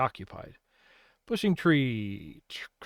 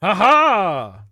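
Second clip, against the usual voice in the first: triumphant gloat, louder and higher; 5 semitones higher, 5 dB louder than usual. Acted. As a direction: crow over the winning move. Gloating over clever move.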